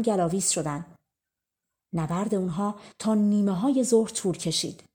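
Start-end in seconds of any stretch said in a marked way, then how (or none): none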